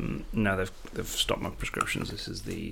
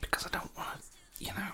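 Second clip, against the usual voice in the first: hushed whisper; 12 dB quieter, faster than usual. Acted. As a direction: keep it secret, whisper. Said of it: "why are you whispering so much?".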